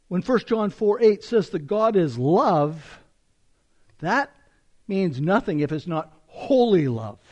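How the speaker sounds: ordinary talk, nothing marked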